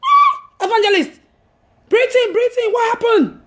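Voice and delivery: high, loud alarmed questions; playing a startled friend asking what happened; surprised friend. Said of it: "Gina was like".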